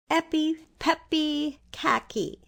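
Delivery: slow, high chant; reciting a charm. A narrator's reading. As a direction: read the spell carefully. Reading the charm.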